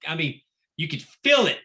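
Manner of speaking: ordinary talk, nothing marked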